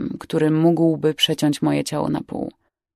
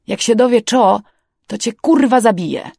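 louder, raised threat; angry threat; 4 semitones higher, 5 dB louder than usual. Acted. Older man threatening Carlos up close, angry. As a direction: threaten him, punching the curse. Boss threatens careless crewman.

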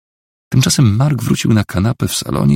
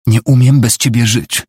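loud, higher cry; raised, pleading outcry; desperate shout; 4 semitones higher, 7 dB louder than usual. A narrator's reading. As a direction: call it out after someone leaving. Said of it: "krzyknęła".

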